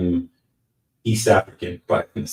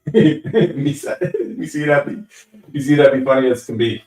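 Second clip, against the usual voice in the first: giggling through the line, louder; laughing reread; 7 semitones higher, 5 dB louder than usual. Acted. Streamer rereading a viewer's odd comment, laughing. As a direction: reread the comment while cracking up.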